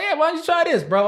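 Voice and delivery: high-pitched, offhand imitation; mock nonchalant. Imitating a friend's late advice, mock nonchalant. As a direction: toss it out as a casual suggestion.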